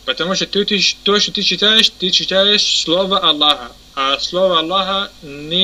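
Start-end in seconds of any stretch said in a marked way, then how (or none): none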